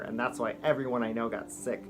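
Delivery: ordinary talk, nothing marked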